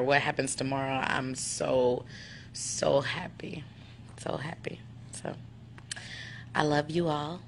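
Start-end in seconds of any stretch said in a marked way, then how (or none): 2.5-5.3 breathless, slow whisper; tearful whisper of joy. Real, not acted. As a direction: whisper it, overcome with emotion. Overwhelmed, whispering her happiness.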